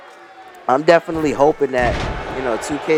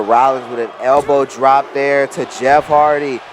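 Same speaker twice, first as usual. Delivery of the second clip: excited wrestling play-by-play, higher and louder; wrestling commentator; 5 semitones higher, 5 dB louder than usual. Acted. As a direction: call the match like a ringside announcer. Live play-by-play of game match.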